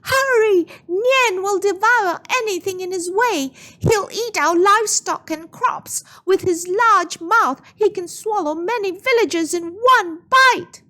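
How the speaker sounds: high, loud fearful warning; Mama hurrying everyone to hide, fearful high urging; frightened urging. Acted. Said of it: "Mama herded the animals".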